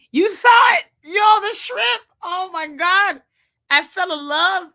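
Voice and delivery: high, loud real excitement; gushing, loud food-lover's delight; thrilled. Real, not acted. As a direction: rave about food you adore. Raving about the shrimp picture.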